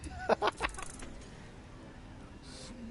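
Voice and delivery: breathy laugh. Real, not acted. Laughs at the monster.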